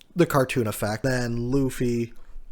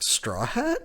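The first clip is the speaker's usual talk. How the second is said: much higher questioning call; stunned; 11 semitones higher than usual. Retold or imitated. Kid shocked to see Straw Hat again, much higher questioning tone. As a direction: disbelief at seeing him alive. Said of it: "Kid's like".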